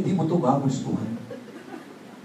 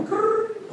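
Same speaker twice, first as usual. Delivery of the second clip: phone ring. Retold or imitated. Imitating phone ringing.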